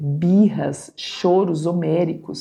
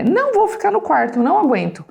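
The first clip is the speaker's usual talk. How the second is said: defiant daughter; 9 semitones higher, faster than usual. Acted. Daughter storms out of her room, high and fast. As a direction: burst out like a fed-up girl.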